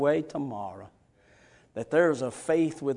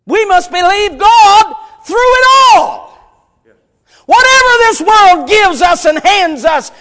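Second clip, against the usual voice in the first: high, shouted sermon with long-held key words; impassioned preacher at full volume; full-force preaching; 14 semitones higher, 17 dB louder than usual. Real, not acted. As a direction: shout it out to the congregation.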